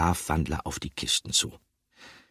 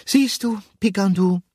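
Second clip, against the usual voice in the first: sleepy call; 7 dB louder than usual. A narrator's reading. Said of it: "rief Gruyère schläfrig".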